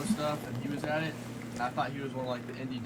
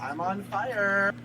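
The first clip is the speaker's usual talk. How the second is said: high, loud and slow camp boast; hammed-up camp cheer after a goal; 7 semitones higher, 8 dB louder, much slower than usual. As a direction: stretch it out, camp and thrilled. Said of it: "keep talking like this".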